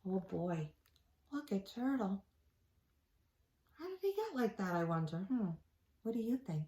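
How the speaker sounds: put-on soft question to the kids; quiet aside. Reader asking the children, softly. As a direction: ask the listeners gently.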